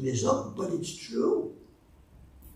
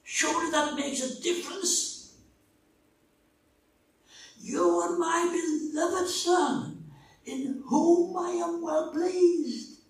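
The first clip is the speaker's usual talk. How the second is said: higher, slow and tender, like a father to a beloved son; God's voice, tender, much higher and unhurried; loving voice of God; 11 semitones higher, 4 dB louder than usual. Retold or imitated. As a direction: lift the voice high and speak with fatherly love. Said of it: "this is what he said to me".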